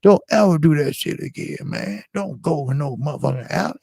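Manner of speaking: high, tearful scolding of his friend; crying scold. Retold or imitated. Scolding his friend after the robbery, high and tearful. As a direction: scold him while sobbing. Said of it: "crying and saying".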